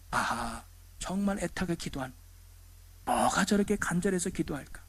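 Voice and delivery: soft, hushed aside to oneself; sympathetic musing. Acted. Listener thinking about someone praying desperately, hushed musing. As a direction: think aloud quietly, with understanding.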